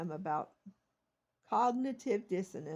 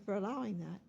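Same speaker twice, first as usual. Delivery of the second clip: ordinary talk, nothing marked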